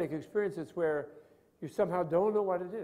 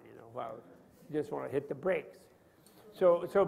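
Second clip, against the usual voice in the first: ordinary talk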